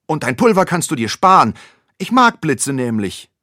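put-on boy defying the monster out loud; loud and defiant. A narrator's reading. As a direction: throw it back at the monster boldly. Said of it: "sagt Ole laut".

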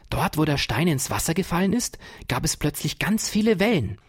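put-on curious boy Ferdinand eagerly answering; eager young boy. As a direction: answer like a keen little boy. Ferdinand's child voice.

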